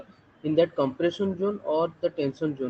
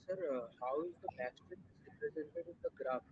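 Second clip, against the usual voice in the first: faint, far from the mic; barely audible question; quiet voice; 2 semitones lower, 17 dB quieter than usual. Real, not acted. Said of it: "Your, your voice is very low".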